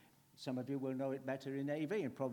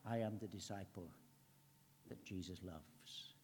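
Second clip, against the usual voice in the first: soft confession; 7 semitones lower, 10 dB quieter than usual. Pretend. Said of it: "walk out of this place tonight saying".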